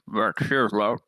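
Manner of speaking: a light teasing call over the shoulder; playful in-character call. Acted. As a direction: toss it out with a sandwich in your mouth.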